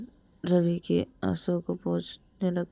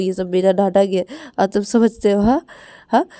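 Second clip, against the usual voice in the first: pleading; 7 dB louder than usual. Acted. Rahul pleading loudly with crying Ravi.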